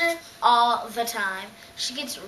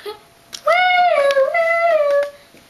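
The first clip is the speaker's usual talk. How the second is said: very high, loud held whoop; gleeful whoop; 11 dB louder than usual. Acted. Little sister loving her role, gleeful whoop. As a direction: let out a long shrieky woo.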